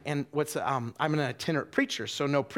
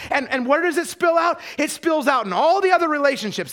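high, loud, driving sermon delivery; impassioned preacher building to a shout; full-force preaching; 12 semitones higher, 11 dB louder than usual. Real, not acted. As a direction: hammer out the list at full volume.

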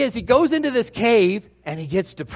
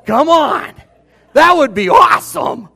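higher, louder enthusiastic shout; excited shout; 6 semitones higher, 9 dB louder than usual. Real, not acted. Preacher hyping the Bible story to congregation, excited shout. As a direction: sell it like a great movie.

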